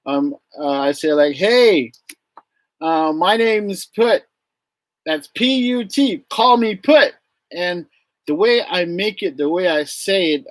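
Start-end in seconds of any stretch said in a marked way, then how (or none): 1.3-7.1 high, lively self-introduction; casual introduction. Retold or imitated. Teacher introducing himself to the kids, untextbook and lively. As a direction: introduce yourself breezily, building in pitch. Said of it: "it's like not using a textbook".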